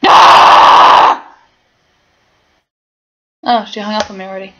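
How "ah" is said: loud unvoiced shriek of alarm; short sharp scream of surprise; sudden startled yell. Real, not acted. Startled shout alone.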